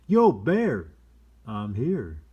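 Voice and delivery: loud call of yo, bear; a loud warning yell on a hiking trail. Acted. Quoted warning yell in book.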